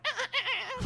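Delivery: mock animal squeaks way up high; raccoon chatter. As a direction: chitter like a startled raccoon. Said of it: "they were all like".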